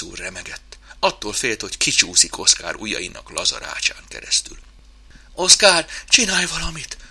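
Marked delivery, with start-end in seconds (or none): 5.3-7.0 high, frightened whine; whimpering plea. Acted. Scared mouse begs chimp for help, whimpering. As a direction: plead in a high little voice, loud on the name. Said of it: "nyöszörgött".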